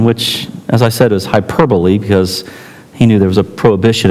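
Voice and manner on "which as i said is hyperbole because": usual speaking voice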